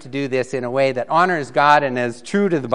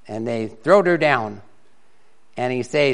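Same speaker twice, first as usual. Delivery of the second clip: lively, loud storytelling read; comic story reading; 5 dB louder than usual. Acted. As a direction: read the mixed-up Bible tale for laughs. Reads a comic mixed-up Bible story.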